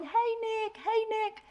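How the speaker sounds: very high, soft singsong reply; sweet greeting. Retold or imitated. Passersby answering a friendly little boy, sweet high-pitched greeting. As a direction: coo it back to a little child, high and gentle. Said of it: "they're so sweet. They're going".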